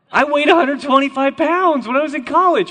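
loud, high-pitched exclamation; mock outrage. Real, not acted. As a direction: blurt it out loudly in comic indignation. Mock outrage about own small weight.

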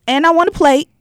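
loud, high, excited. Real, not acted. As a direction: hype it up, fast and loud. Excited about visiting families.

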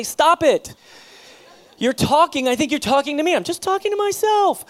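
high, loud, laughing wife voice; imitating his wife. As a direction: play her, caught talking to herself. Wife's embarrassed reply, acted.

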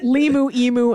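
ordinary talk

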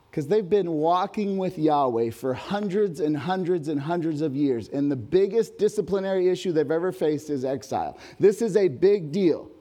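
loud, high-pitched preaching that builds; impassioned preaching. Acted. Preacher urging the congregation to face exile's weight, at full force. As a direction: drive the point home to the crowd.

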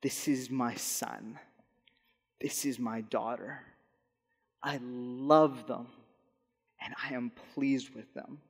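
soft, slow, tender; tender divine voice. Pretend. Pastor voicing God blessing his child, softly tender. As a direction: speak it like a loving father. Voicing God's words of love.

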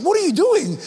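high, shocked question; imitating a startled dad scolding; alarmed dad. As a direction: demand it in disbelief. Dad confronting son.

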